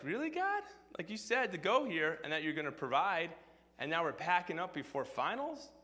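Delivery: high-pitched disbelieving question; incredulous complaint. Retold or imitated. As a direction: question God in mock dismay, rising at the end. Acted disbelieving complaint to God.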